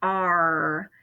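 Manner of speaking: growled pirate arr; pirate sound. Shown as a demonstration. Teacher showing first graders a pirate arr. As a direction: say it like a pirate would. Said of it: "like a pirate would say".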